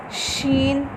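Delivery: "शीन" with a full mouth, soft; full-mouthed sheen. Shown as a demonstration. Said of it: "मुंह भर के आवाज़ निकाली।". ("शीन" मुंह भर के)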